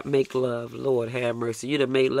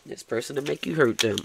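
ordinary talk

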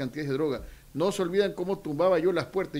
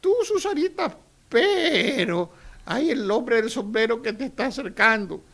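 mock magic mirror; 5 semitones higher than usual. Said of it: "el espejo".

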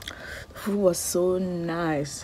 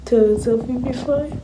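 tearful self-pep talk; 6 semitones higher, 7 dB louder than usual. Real, not acted. Pulling herself together, crying.

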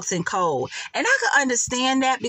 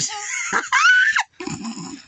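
shrieking laugh. Real, not acted. Shrieking laugh at Nesto cutting off Sonya and Dion. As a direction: howl with laughter at the phone call.

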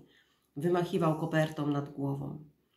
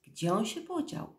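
higher-pitched, wondering question; puzzled wondering; 7 semitones higher than usual. A narrator's reading. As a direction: wonder aloud, pitch climbing. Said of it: "zastanowił się Winston".

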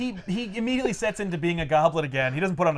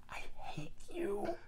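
slow whispered last words, acted; choked dying breath; 5 semitones higher, 15 dB quieter, slower than usual. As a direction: choke out hatred with your final breath. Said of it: "just shaking and convulsing".

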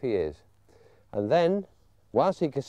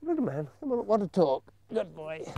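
coaxing puppy talk; 4 semitones higher than usual. Shown as a demonstration. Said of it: "They won't all".

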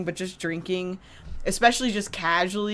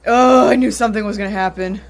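frightened; 7 dB louder, faster than usual. Real, not acted. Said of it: "this is not exaggerated how I'm reacting".

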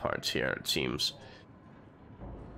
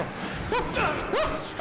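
high-pitched nervous giggle; a squeaky panicked laugh; high giddy laugh. Real, not acted. Laughing during chase.

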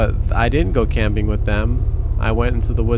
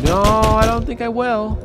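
mocking high voice; 9 semitones higher than usual. Mocking reply to the NPC.

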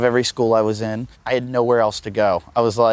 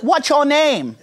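very high, mock Indonesian voice; bad Indonesian accent; 13 semitones higher, slower than usual. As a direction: imitate an Indonesian call-center woman, high-pitched. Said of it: "forgive me for this bad Indonesian accent".

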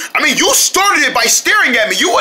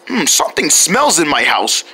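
ordinary talk, nothing marked

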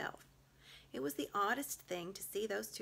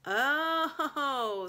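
high, loud scornful beaver; a sharp knowing Oh; 9 semitones higher, 10 dB louder than usual. A narrator's reading. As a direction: Beaver seeing through the witch. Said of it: "said Mister Beaver".

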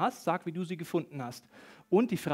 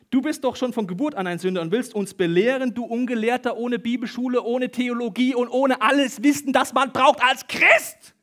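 loud, raised-pitch tirade building to a yell; furious shouting; 6 semitones higher, 8 dB louder than usual. Acted. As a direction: berate him in outrage, louder and louder, dragging out the last words. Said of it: "Wütend schrien sie ihn an".

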